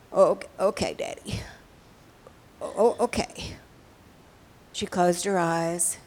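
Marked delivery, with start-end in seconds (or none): 0.1-3.6 put-on halting, hiccupping little-girl okay; tearful stammer. Frightened girl reassured that Daddy sees her, tearful. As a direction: stammer it out between small sobs. Frightened child choking back tears.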